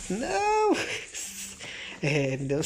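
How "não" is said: high shriek of dismay; real high scream. Real, not acted. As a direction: cry out as the round is lost. Loses the fight.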